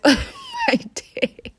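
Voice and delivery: words breaking into laughter; laughing. Real, not acted. Admitting chips made her day, laughing it out. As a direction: laugh through the end of the line.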